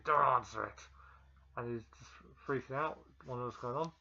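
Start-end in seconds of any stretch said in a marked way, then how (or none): 0.0-0.8 loud, forceful Venom command; voice in the head. Acted. Venom warns Eddie about the door, loud commanding voice. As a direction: boom it out as an urgent inner warning. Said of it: "hears this voice in his head saying".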